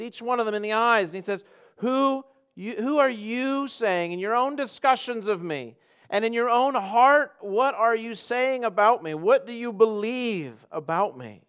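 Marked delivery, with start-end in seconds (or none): 1.8-11.4 high, slow, pressing question; Jesus questioning emphatically. Acted. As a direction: lean hard on "you" in a challenge to the disciples. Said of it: "it's very emphatic".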